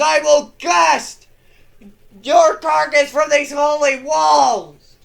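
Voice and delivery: zealous knight bellowing at the aliens; furious war cry. Acted. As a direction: roar your name at the foe from the walls. Grimaldus roaring at the enemy.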